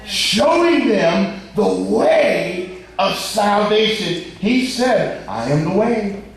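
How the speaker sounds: high-pitched, ringing sermon delivery; emphatic preaching. Real, not acted. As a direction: proclaim it with soaring stressed words. Preacher at full force.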